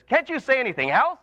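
high exasperated outburst at the parrot; frustrated burglar. Acted. Burglar fed up with the parrot, exasperated and high. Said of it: "finally gets frustrated".

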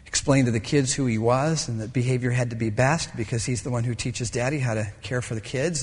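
normal talking voice

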